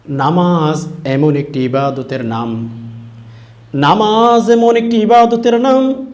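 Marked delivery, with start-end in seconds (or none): 3.7-6.1 high, loud and drawn out; raised preaching cry. Real, not acted. Preacher proclaiming what prayer is, raised preaching cry. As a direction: proclaim it slowly at the top of the voice.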